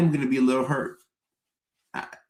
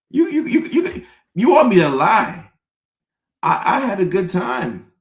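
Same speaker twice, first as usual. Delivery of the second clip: much higher, louder, laughing protest; incredulous; 7 semitones higher, 6 dB louder than usual. Acted. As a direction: squeak out disbelief.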